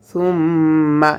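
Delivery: "সুম্মা" with a long hummed double m; ghunnah. Shown as a demonstration. Teacher showing ghunnah, humming doubled m in "সুম্মা". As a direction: hold the m of "সুম্মা" through the nose. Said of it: "এখানে গুন্না হবে".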